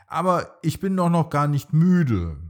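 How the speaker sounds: put-on high, loud whiny child protest; the little dino protests to his dad, high and loud. A narrator's reading. Little Bronto tells his dad he isn't tired, in a high, loud child's protest. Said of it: "antwortet der kleine Dino Bronto".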